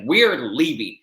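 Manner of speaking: loud, irritated imitation; mock pissed-off. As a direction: imitate an angry order to go. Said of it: "And then she got pissed".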